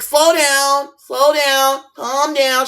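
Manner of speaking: put-on old teacher. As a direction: play the flustered old teacher trying to settle the class. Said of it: "She was old as hell".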